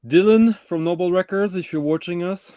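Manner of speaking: loud, high shout-out; calling out. Real, not acted. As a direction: call out to the camera. Calling out to a viewer.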